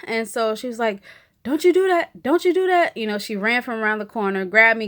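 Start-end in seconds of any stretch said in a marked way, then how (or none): 1.4-2.9 higher and louder, a caring scold; imitating a nurse's firm, caring no; firm comfort. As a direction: reassure her, stop her spiralling. Nurse comforting crying patient.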